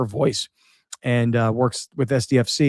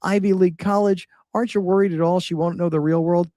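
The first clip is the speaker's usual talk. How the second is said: own younger self quoted; 7 semitones higher than usual. Retold or imitated. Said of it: "'cause I was like".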